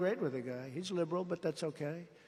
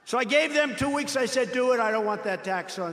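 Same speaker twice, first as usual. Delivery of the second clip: raised rally voice; 7 semitones higher, 8 dB louder than usual. Real, not acted.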